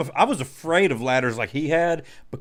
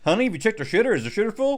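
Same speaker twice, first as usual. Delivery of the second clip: quoting Clark; 7 semitones higher, much faster than usual. Retold or imitated. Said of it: "turns around to his wife and says".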